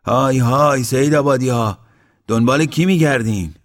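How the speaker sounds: loud, high hailing shout; loud call. A narrator's reading. Mashti calls to the newcomers who they seek, loud call. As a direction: call out loudly to people far off. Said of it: "با صدای بلند گفت".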